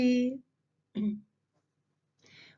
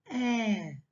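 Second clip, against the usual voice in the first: normal talking voice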